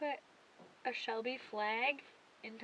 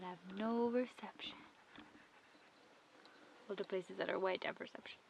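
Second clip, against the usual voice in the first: whisper; 3 semitones lower, 6 dB quieter than usual. Real, not acted. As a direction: hush it to a whisper. Whispering while looking at the map.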